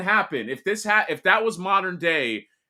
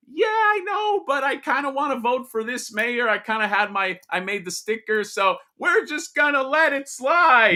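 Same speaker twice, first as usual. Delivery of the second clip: much higher, goofy and careless; high silly voter voice; 9 semitones higher than usual. Retold or imitated. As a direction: squeak it out like a clueless voter. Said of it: "And people be like".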